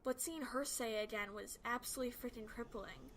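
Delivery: ordinary delivery, nothing marked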